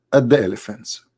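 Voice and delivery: ordinary talk, nothing marked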